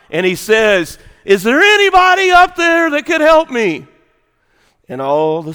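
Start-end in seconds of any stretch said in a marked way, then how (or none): 1.2-3.9 high, loud call held on one pitch; screamed cry for help. Acted. Hiker hanging off cliff calls upward, screamed cry for help. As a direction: holler far up to whoever might hear. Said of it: "he screams and he cries out".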